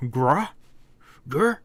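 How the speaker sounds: questioning little growl, rising at the end; loud, high-lifted creature grunt; monster growl noise. Acted.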